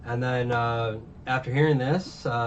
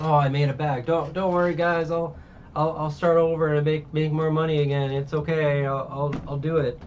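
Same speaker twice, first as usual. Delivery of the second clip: tag; 5 semitones higher, 4 dB louder than usual. Retold or imitated. Acting out character reassuring villagers.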